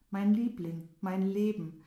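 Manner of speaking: put-on calling out loud to the girl at the window; loud lovesick call. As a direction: cry out to her, desperately in love. Lovesick prince calls to the girl. (lauter, verliebter Ruf)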